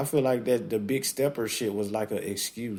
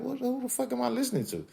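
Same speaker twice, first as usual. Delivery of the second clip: much higher, fast and baffled; 14 semitones higher, faster than usual. Retold or imitated. Listener hearing the album, baffled high fast question. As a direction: blurt it out in high confusion. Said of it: "I'm like".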